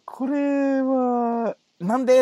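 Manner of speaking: high, loud bewildered why; baffled why. Retold or imitated. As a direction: exclaim it in disbelief. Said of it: "って思ったでしょうね". (驚いた「なんで？」)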